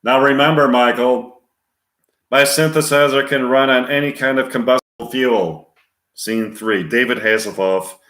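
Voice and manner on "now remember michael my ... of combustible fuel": higher, louder, even robotic monotone; computer-like voice. Acted. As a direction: talk like a car's onboard computer. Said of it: "computer-like voice".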